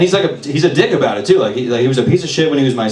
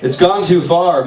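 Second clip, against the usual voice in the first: ordinary delivery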